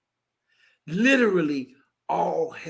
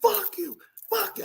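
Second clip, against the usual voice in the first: much higher pitched mock cursing; mock shrill curse; 13 semitones higher than usual. Imitating the girl cursing him in the stands, high mock yell. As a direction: squeal the curse like the angry girl. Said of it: "girl cussing him out".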